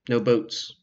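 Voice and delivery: ordinary talk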